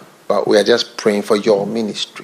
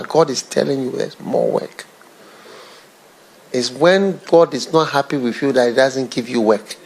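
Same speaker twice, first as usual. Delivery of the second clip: ordinary talk, nothing marked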